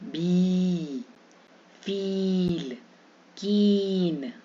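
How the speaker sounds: बी, फील, कीन with the "ee" held long; drawn-out ee on बी, फील, कीन; long double ee. Shown as a demonstration. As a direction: stretch the vowel in बी, फील, कीन. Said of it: "उच्चारण इसका दीर्घ हो जाता है".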